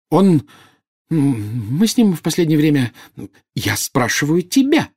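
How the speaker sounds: high, halting then hurried deflection; stumble, then turn the question back defensively; flustered. A narrator's reading. Bellatrix caught out about the Dark Lord, flustered.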